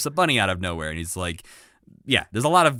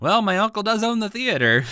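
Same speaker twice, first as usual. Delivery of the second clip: high, put-on voice of a smug little schemer; smug Scooter impression; 13 semitones higher, 4 dB louder than usual. Host imitating Scooter blackmailing the Muppets, high and smug. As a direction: toss out the uncle card in a high, smug voice.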